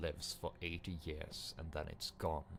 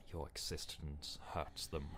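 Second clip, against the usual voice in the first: ordinary delivery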